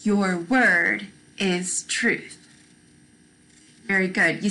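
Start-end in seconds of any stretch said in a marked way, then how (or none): 0.0-2.4 very slow, each word laid out; slow model recital. Shown as a demonstration. Teacher modelling the verse slowly for children. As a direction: say it slowly so kids can copy. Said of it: "You try it".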